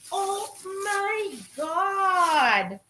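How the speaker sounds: very slow, drawn-out Oh my God; awestruck. Real, not acted. Unwrapping the red purse at last, slow awestruck gasp. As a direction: let it stretch out in awe.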